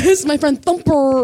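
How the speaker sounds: a light mock Chicano gang accent; Latin gang accent. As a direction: play a Latin Kings member acting tough. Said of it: "a joke about the Latin kings".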